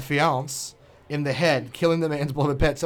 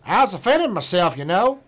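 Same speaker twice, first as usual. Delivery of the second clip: a mock Florida man accent, higher and louder; 4 semitones higher, 4 dB louder than usual. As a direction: put on the Florida man's accent for his quote. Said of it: "his accent".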